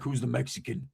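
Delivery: low, hushed big-man voice; big man's voice. Retold or imitated. Huge boss asking his crew about a stranger, low and quiet. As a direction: ask it low and under the breath. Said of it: "that's how he talked".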